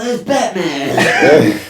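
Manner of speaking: mock startled henchman cry, slow and drawn out; henchman yell. Joking about Joe Rogan, a henchman spotting Batman. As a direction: ham up a goon spotting Batman. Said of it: "They're like".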